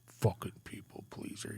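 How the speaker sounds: whispered under the breath, barely voiced; muttered insult. Old counselor muttering at the client who handed over his lighter. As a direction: mutter it to yourself, not meant to be heard.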